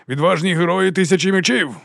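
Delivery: high, ringing rallying call; ringing oration. A narrator's reading. Captain hails his thousand swords, ringing oratory. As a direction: proclaim it like a commander to his troops. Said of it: "Його голос дзвенів у пахучому ранковому повітрі".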